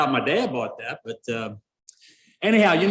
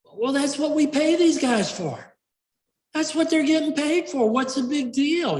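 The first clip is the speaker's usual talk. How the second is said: much higher put-on caller voice; mock radio caller; 11 semitones higher than usual. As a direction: play a dismissive caller in a high-pitched voice. Acting a grumbling radio caller.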